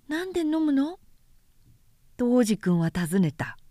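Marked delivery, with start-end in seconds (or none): 0.1-1.0 put-on light, high boyish voice, curious; a small boy asking innocently; little prince voice. A narrator's reading. Little prince asking the vain man, high childlike voice.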